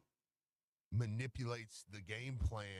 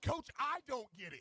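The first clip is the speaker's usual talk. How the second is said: high, louder mock protest; bewildered plea; 12 semitones higher, 4 dB louder than usual. Assistant telling head coach he's lost, bewildered plea. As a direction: plead in exaggerated confusion, pitched way up. Acting himself pleading with coach.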